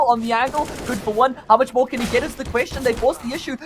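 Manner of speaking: loud, high rising hype; excited play-by-play. Real, not acted. Live play-by-play of the ambush.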